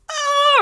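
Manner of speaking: high, crying "oh"; acted whimper of dread; fearful wail. Retold groan, afraid to poop.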